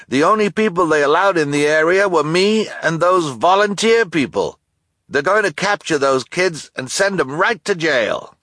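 high, loud gruff delivery man; delivery person voice. Acted. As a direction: play the blustering delivery man.

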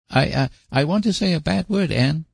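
put-on high, halting boy forcing it out; desperate blurt. A narrator's reading. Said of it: "blurted out Davy, with a desperate effort".

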